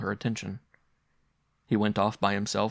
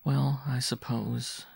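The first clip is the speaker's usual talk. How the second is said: very slow, reluctant reply; slow and bitter; 4 semitones higher, much slower than usual. A narrator's reading. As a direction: draw it out with a touch of bitterness. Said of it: "she said slowly and bitterly".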